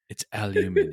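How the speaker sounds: "aluminium" said the British way, five syllables; British pronunciation. Retold or imitated. Comedian defending "aluminium" the British way. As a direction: stress "aluminium" on the "ni" like a Brit. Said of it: "I'm saying it the correct way".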